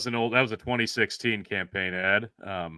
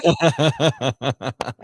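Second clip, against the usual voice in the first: burst of laughter; 3 semitones higher, 4 dB louder than usual. Real, not acted. Cracking up at campaign meme.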